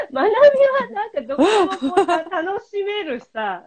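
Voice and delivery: loud, high, bursting with real laughter; laughing tease. Real, not acted. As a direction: talk through a big laugh. Laughing at the friend's easygoing answer.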